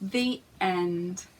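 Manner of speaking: plain reading voice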